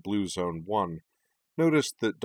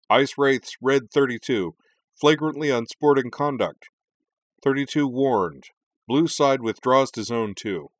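loud, raised arena announcement; amplified referee announcement; 5 semitones higher, 7 dB louder than usual. A narrator's reading. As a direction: project to the far corners of the arena. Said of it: "his voice amplified to reach the far corners".

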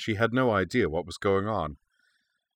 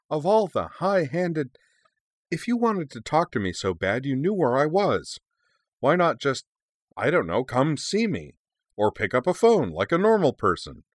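high, swooping indignant complaint; exasperated rant; 7 semitones higher than usual. Acted. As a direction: sputter in exasperation, pitch jumping up. Garnet rants at her cousin.

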